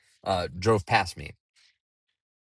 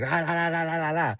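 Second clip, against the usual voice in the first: higher, mock barking dog; imitating dog barking; 6 semitones higher than usual. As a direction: bark like the yappy neighbor dog. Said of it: "is barking at me like".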